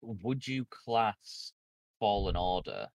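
ordinary delivery

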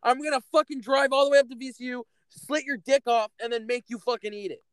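high, rapid-fire angry tirade; exasperated rant. Real, not acted. Friend threatening the one who talked over them, exasperated rant. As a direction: lay into them, fast and heated.

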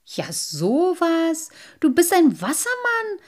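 very high, slow exclamation; astonished boys. A narrator's reading. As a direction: gasp in amazement. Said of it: "riefen die Jungen".

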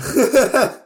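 loud, high laugh; real burst of laughter. Real, not acted. Host cracking up at co-host's ghost wail, loud laugh.

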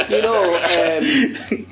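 usual speaking voice

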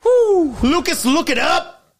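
high, loud whoop; excited cheer. Real, not acted. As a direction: hype him up. Cheering on co-host.

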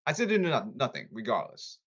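ordinary delivery

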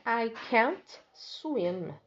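"can't" stretched, falling; slow model sentence. Shown as a demonstration. Teacher modeling the mouse's negative line, slow. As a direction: spell it out in sound. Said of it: "A segunda frase dele é".

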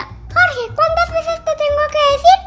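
high, fast scolding granny; scolding old lady. A narrator's reading. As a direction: scold like a little old mother. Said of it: "dijo la viejecita".

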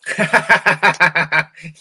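sharp high mocking laugh; scornful laugh. Real, not acted. Reacting to the government's promise with a scornful laugh.

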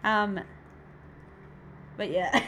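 ordinary talk

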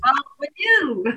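giggling high mock welcome; high giggling reply; laughing. Real, not acted. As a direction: laugh through it. Laughing along.

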